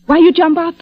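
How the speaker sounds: high, alarmed questions; startled. Acted. Fifi startled as the man jumps up, alarmed. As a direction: jump in high and quick to alarm.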